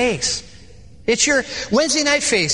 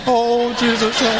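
praying wife; slower than usual. Retold or imitated. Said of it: "And my wife now, two against one".

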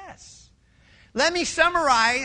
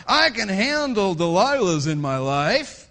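lightly voiced cocky strongman; a hint of swaggering pride; boastful Samson; 3 semitones lower, 6 dB louder than usual. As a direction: puff up like a man who can't lose.

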